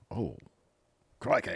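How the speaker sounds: badly faked Australian exclamation; terrible Australian accent. Host putting on a wrestler's accent, bad Australian. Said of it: "caught me with my Australian accent".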